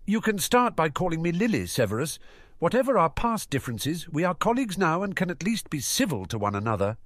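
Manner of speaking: lightly voiced Lily, reaching out; earnest young woman voice.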